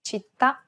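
Città with the last syllable stressed; stress on final vowel. Shown as a demonstration. Host shows grave accent examples, final à stressed in Città. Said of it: "end of a word can have a grave accent".

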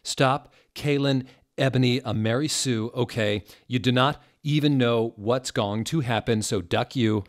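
loud, high, indignant scolding of the readers; angry author rant. Acted. Fed-up author scolding her critics, loud and high. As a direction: tell the flamers off, fed up.

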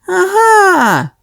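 very high triumphant aha. A narrator's reading. Sonya hatches her plan, high aha. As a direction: seize on the idea with a high aha. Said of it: "подумала Соня".

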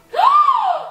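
loud, high gasp; shocked gasp. Real, not acted. Finding something in the trash can, shocked gasp. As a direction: gasp at a sudden discovery.

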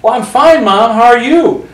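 high, loud imitated chat; acting out a big-voiced phone exchange; cousin's mother. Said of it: "That's the way she talked".

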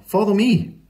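high, lively call to follow; decisive mum. A narrator's reading. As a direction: call them along brightly. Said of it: "said Mum".

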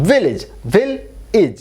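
Village then ভিল and ইজ pulled apart; syllable split. Shown as a demonstration. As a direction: sound out ভিল, pause, ইজ. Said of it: "এই এর উচ্চারণ হচ্ছে ই". (ভিল আর ইজ ভেঙে বলা)

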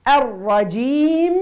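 الرجيم with the mim held long; hold the final mim. Shown as a demonstration. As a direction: let the mim of الرجيم ring its time. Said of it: "الميم لابد تعطيها الزمن".

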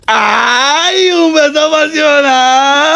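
high, drawn-out teasing wail; mock swooning squeal. Host hyping a passionate kiss, mock swooning squeal. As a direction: stretch it out high, holding the last vowel.

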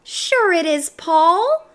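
high, coaxing little creature; high-pitched talking pigtail, persuading; pigtail's little voice. A narrator's reading. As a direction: sweet-talk him in a small voice. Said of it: "said the pigtail".

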